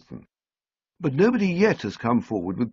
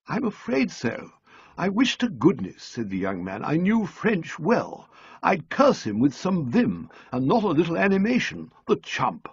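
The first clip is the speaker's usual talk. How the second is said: put-on young man; 8 semitones higher than usual. A narrator's reading. Young man voiced by the narrator.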